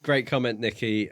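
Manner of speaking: ordinary talk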